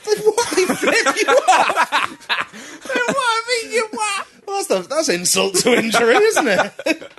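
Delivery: high, loud laughing disbelief. Real, not acted.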